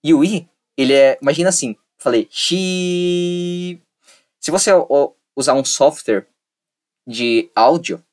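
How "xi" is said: xi held long on one level pitch; a slow, level xi; flat drawn-out xi. Shown as a demonstration. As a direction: draw xi out slowly like a straight line. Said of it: "ele vai ficar assim o áudio, reto".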